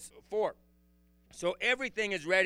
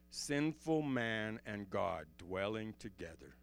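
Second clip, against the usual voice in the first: hushed awe; 8 semitones lower, 6 dB quieter than usual. Real, not acted. Preacher marveling at God's mercy, low and hushed. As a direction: sink to a reverent hush.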